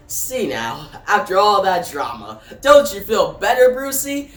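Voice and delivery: rising, taunting villain lilt; comedian Joker. A narrator's reading. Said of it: "comedian Joker ... says".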